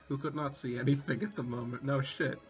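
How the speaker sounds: ordinary talk, nothing marked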